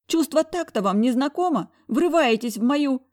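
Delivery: high, loud outraged scolding, slowing; indignant. Acted. Heroine scolds her boss for barging in, outraged. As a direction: scold him, shocked and offended.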